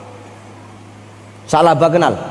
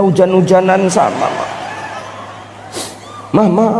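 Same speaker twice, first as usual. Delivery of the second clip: high fake wailing for mama; mock crying husband; 3 semitones higher than usual. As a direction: ham up the husband's sobbing. (tangisan suami yang dibuat-buat)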